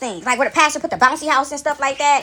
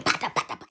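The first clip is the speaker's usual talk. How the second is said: rapid, voiceless popping syllables; imitated clapping; 10 dB quieter than usual. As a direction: mimic sharp hand claps with the mouth. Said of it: "like, like clapping".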